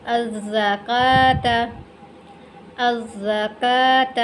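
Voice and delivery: अज़्ज़कात slowly, doubled z and long kaa; silent waw. Shown as a demonstration. Teacher models word for learners, slow recitation. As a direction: hold kaa in अज़्ज़कात, no w sound. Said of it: "ये वाला वाव भी नहीं पढ़ेंगे".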